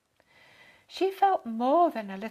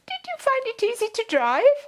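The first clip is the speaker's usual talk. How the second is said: fussy lady; 13 semitones higher, 10 dB louder than usual. A narrator's reading. Lady asks Dad about driving.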